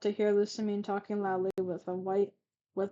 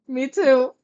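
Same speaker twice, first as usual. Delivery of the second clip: loud, higher put-on interjection; emphatic, raised-voice agreement; chiming in; 4 semitones higher, 12 dB louder than usual. A narrator's reading. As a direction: pipe up loudly in a character voice. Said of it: "Harlan chimed in".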